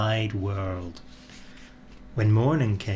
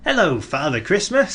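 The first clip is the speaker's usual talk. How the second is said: higher snowman voice, friendly; cheery snowman greeting; 6 semitones higher, 3 dB louder than usual. A narrator's reading. Snowman greeting Father Christmas, cheerful. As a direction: greet him brightly, a bit higher. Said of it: "said Mister Snow".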